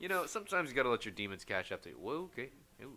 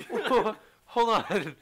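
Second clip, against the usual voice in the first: drawn-out shocked whoa, laughing; slow, loud, stunned and laughing; startled whoa; 12 semitones higher, 15 dB louder, much slower than usual. Retold or imitated. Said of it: "I'm like".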